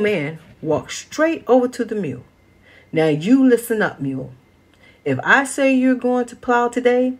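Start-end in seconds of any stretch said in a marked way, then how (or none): 2.9-7.1 stern scolding. Acted. Grandfather scolds the stubborn mule.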